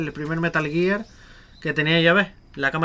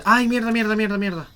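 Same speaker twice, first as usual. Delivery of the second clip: sudden high loud swearing; frustrated cursing; 6 semitones higher, 8 dB louder than usual. Real, not acted. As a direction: blurt out curses at a mistake. Game mishap, sudden cursing.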